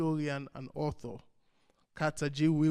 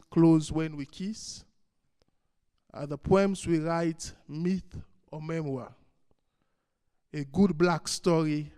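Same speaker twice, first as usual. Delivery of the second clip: louder, measured poem recital with long pauses; poetry reading; 4 dB louder than usual. Acted. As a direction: declaim each line, then let it hang. Reads own poem to audience.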